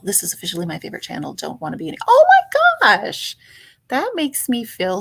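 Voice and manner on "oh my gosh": very high, slow, delighted oh my gosh; high, thrilled Oh my gosh; excited squeal. Real, not acted. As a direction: squeal with joy. Thrilled by a viewer's comment.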